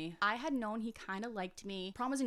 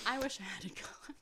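whispered aside; 4 semitones higher than usual. Real, not acted. Sighing to her cohost about missing prom, whispered. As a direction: breathe it out as a private wish.

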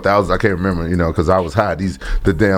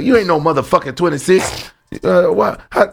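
mimicking Yakuza guys; 7 semitones higher than usual. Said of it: "They was like".

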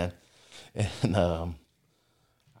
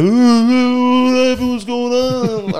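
high, slow, dazed just-woken voice; groggy confusion; 14 semitones higher, 7 dB louder, much slower than usual. Retold or imitated. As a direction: play the drunk friend jolted awake, louder. Groggy friend woken suddenly.

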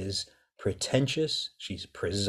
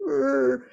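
disgusted groan. Retold or imitated. Disgust at awful character.

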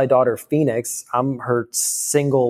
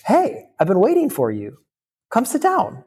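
light, high and playful, much higher voice; angelic playful woman; 11 semitones higher than usual. Retold or imitated. Woman in his dream greets him, high and playful. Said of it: "she jumps up in this, like, angelic, playful manner".

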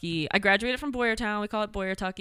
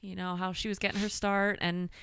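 ordinary talk, nothing marked

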